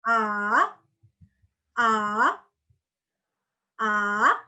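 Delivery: curve "A" down and back up; dipping third tone. Shown as a demonstration. Teacher demonstrating tone three, dipping tone. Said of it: "Melengkung dia".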